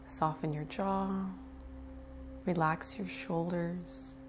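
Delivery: unhurried meditation guide with long pauses; guided meditation. Acted. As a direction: ease them into relaxation, leaving space between phrases. Leads breath awareness meditation.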